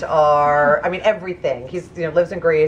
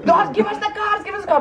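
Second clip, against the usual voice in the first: high, rushed, half-laughing panic; mock panic; 9 semitones higher, faster than usual. Tourist fearing jail and begging for the cards, mock panic. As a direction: gabble it in exaggerated alarm.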